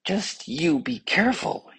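high-pitched pointed caution; pointed warning. A narrator's reading. Tongue warns the other body parts, pointedly. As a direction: warn them pointedly, voice lifted high. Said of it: "thrust itself out very pointingly".

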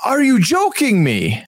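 stretched-out, high-pitched disbelief; mock disbelief. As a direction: imitate someone who can't believe it. Acting out his disbelieving reply.

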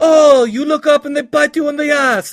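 loud, high hammed-up Jamaican accent. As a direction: belt it out in a bad Jamaican accent. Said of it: "That was the worst Haitian or Jamaican, accent ever".

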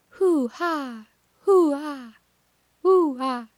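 high hooting owl call; playful owl hoot imitation; barred owl call. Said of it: "the barred owl, I think, sounds like".

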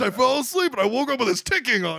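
high, hammed-up tearful regret; mock lament. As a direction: lament like a melodramatic victim.

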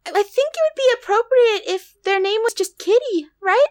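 put-on high cutesy e-girl voice; teasing Discord kitten voice. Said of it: "What if I spend the rest of the episode doing this".

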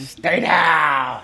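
imitating a boxing trainer's cry; mock trainer's call; Mickey Goldmill. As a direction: stretch out "down" like a cornerman yelling. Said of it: "Mickey tells him to stay down".

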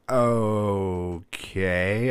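long, drawn-out, hesitant okay; wary dad. Retold or imitated. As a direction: imitate a dad bracing for bad news. Dad warily answers the confession.

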